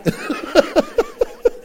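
high laugh at his own joke. Real, not acted. Pastor laughing that the joke is horrible but funny. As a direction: let the laugh ring out.

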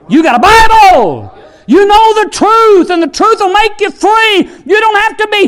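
high, impassioned pulpit shout; real fiery preacher's cry; full-force preaching. Real, not acted. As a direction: drive each phrase home with fervor.

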